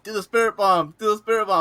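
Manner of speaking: high, loud hyping-up chant; retold yelling. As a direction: yell it like egging on a cosplayer. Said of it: "started yelling at him".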